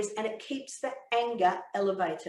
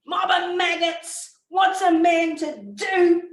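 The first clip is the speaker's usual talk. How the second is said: loud, high, slow exasperated outburst; acted self-pitying rage; angry husband; 7 semitones higher, 7 dB louder, slower than usual. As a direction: storm out shouting your contempt. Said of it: "he says something along the lines of".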